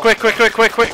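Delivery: loud, high urging; urgent hurry. Real, not acted. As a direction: rush them along.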